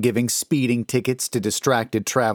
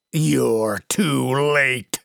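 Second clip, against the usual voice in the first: very slow, croaking demon voice; deep croaking demon; 2 semitones higher, much slower than usual. A narrator's reading. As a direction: croak it out like something from the pit. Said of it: "a deep voice croaked before laughing maniacally".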